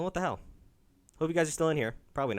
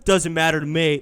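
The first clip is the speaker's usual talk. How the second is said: mock dismissive; 6 dB louder than usual. Streamer mimicking how uncaring they must have looked, mock dismissive. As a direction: put on a louder, couldn't-care-less voice. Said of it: "I look like an asshole".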